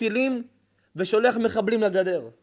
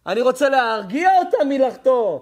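loud, raised rallying cry; impassioned shout; 3 semitones higher, 6 dB louder than usual. Acted. Noah answers those who want him soothing, impassioned shout. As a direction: protest at full voice, pushing the key word.

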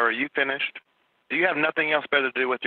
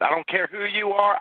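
slow, higher, deliberate words; "I don't care who you are" dragged out and raised in pitch; exasperated; 7 semitones higher than usual. Real, not acted. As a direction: spell it out to a pest, losing patience. Fed-up dispatcher with prank caller.

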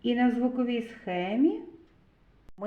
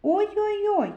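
high pained yelp, oy-oy-oy; зойк; 10 semitones higher, 4 dB louder than usual. Acted. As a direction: cry out "Ой-ой-ой" like a pricked child in a rhyme. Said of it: "схопив і зойкнув". (високий зойк від болю)

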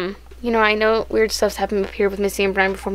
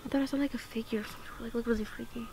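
nervous night vlog whisper; 13 dB quieter than usual. Real, not acted.

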